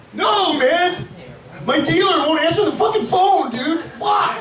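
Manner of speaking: high, frantic mock wail over the dealer; hammed-up despair, voice climbing high; mock anguished wail. Friend wails that his dealer won't answer, hammed-up high despair. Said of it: "your friend is crying".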